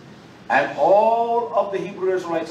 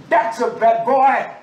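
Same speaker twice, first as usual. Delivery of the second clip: loud, high and slowed for emphasis; savoured loud praise; admiring exclamation; 7 semitones higher, 7 dB louder, slower than usual. Real, not acted. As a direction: call it out in awe.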